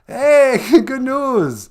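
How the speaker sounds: high, loud announcer burst; joke punchline. Acted. Comedian delivering his joke as a high, loud cheer. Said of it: "Here was my joke".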